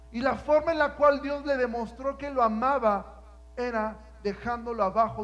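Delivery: loud, rising preacher's cry; preaching at full force. Acted. Preacher shouting Stephen's story to the congregation. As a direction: build the sermon to a shout.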